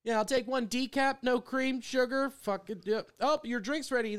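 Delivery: a hint of a breezy barista; mock coffee order. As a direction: rattle it off like a casual Starbucks order. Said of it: "like I'm ordering something at fucking Starbucks".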